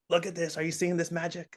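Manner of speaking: high, wonder-filled showing off; thrilled to show kids magic; excited. Retold or imitated. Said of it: "showing movies to kids".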